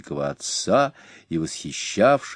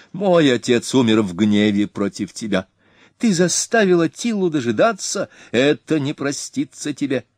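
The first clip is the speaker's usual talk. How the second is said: 1 arrogant threat; 6 semitones higher than usual. A narrator's reading. Ernak threatening the king.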